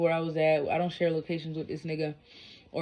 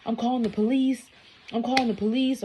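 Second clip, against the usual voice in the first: higher, insistent concerned voice; concerned woman; 7 semitones higher than usual. Retold or imitated. Bystander woman insists on calling police, high worried voice. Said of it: "She's like".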